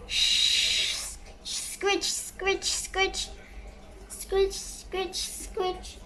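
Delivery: soft unvoiced scratch sounds; whispered sound effects; whispered scritching. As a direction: hush it like a sneaky sound effect.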